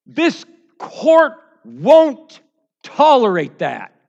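very loud, high and drawn out; shouted courtroom rebuke. Acted. Defense attorney rebuking the prosecutor in court, shouted. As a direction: hammer each word slowly at full voice.

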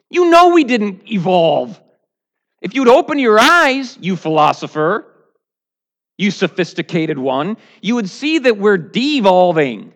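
high, loud impassioned preaching; full-force sermon climax; fiery preaching. Real, not acted. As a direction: preach it hard at the crowd. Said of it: "preaching at full force".

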